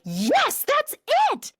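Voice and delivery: high, loud cry of triumph; retold excited squeal. Mom confirms daughter's right answer, retold excited squeal. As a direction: re-enact a jubilant yes, shooting up high. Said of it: "we were squealing together".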